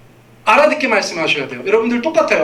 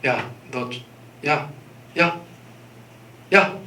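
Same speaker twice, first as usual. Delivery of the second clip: mock feeble, much softer "야"; soft, half-hearted calling; limp half-warning; 6 semitones lower, 7 dB quieter, much slower than usual. Shown as a demonstration. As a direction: mumble the warning as if you don't care. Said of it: "이렇게 하겠어요?".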